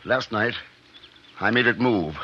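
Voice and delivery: ordinary talk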